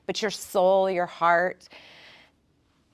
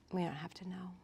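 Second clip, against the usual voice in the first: near-whisper, much softer; whispered thought; 16 dB quieter, faster than usual. Acted. The trained brain answering itself, in a whisper. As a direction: whisper it like an inner voice.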